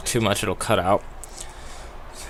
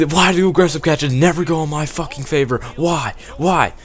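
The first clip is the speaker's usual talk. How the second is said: higher-pitched mock rant at the TV; retold yell at TV; 6 semitones higher than usual. Gamer re-enacting yelling at his TV, mock angry. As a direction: replay your outburst at the screen, pitched up. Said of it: "I'm yelling at my T V".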